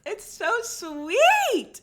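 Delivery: high, loud, drawn-out cooing; gushing. Real, not acted. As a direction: stretch it out in a high gush of delight. Gushes over the rescue.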